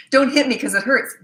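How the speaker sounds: lightly hammed-up parental complaint; mock parent. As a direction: plead like an exasperated parent. Voicing a parent scolding a child.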